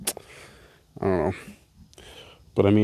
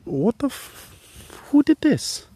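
shocked; much slower than usual. Retold or imitated. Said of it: "And I'm like".

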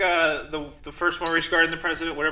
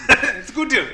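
put-on Indian accent; 8 semitones higher, 8 dB louder than usual. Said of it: "an Indian accent this time".

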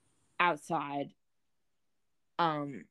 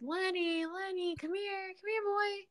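high sing-song call to a cat; 5 dB quieter, slower than usual. Retold or imitated. Searching the dark yard, high coaxing call to Lenny. Calling the lost cat at night.

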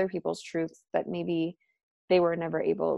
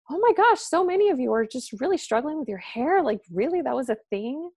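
surprised; 6 semitones higher than usual. Retold or imitated. Her own retold surprised reaction.

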